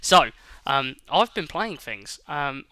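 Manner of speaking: ordinary talk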